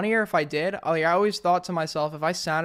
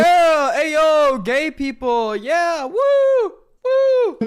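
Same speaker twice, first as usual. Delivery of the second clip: much louder, higher whooping crowd; mock crowd cheer; 8 semitones higher, 8 dB louder, much slower than usual. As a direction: ham up a cheering audience.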